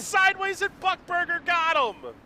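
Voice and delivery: high, loud startled yell; excited shout. Real, not acted. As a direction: cry out as the crash happens. Crash during live finish.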